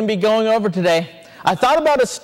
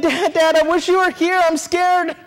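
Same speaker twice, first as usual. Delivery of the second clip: put-on higher-pitched plea of a frightened boy; lightly acted fearful calling for Dad; scared; 7 semitones higher than usual. Boy alone at night hears a bear, scared. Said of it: "And he was scared".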